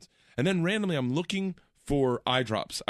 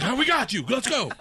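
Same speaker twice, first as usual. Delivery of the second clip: mock rescue shout; 10 semitones higher than usual. Acting out rescuing the dog.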